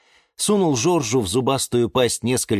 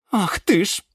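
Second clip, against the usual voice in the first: high indignant gasp of words; high, affronted outburst; choking with indignation; 11 semitones higher than usual. A narrator's reading. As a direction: splutter in outrage. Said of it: "задохнулась от возмущения герань Антонина".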